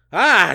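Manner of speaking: sudden loud high yelp of fright; scream. Acted. Reading the dinosaur attack aloud, loud startled scream. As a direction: cry out as the jaws close. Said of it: "you scream".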